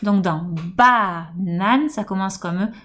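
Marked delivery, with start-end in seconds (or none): 0.8-1.9 "banane" with a long, leaning ba; "banane" slowed, first syllable held; stressed first syllable. Shown as a demonstration. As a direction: stretch the opening ba of "banane". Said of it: "On va accentuer la première syllabe".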